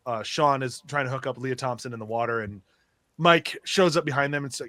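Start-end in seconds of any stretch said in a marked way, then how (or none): none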